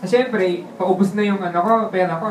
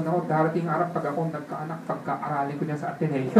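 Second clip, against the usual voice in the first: quiet inner voice; 4 semitones lower, 8 dB quieter than usual. Retold or imitated. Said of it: "sabi ko sa isip ko".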